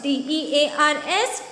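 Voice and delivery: ordinary delivery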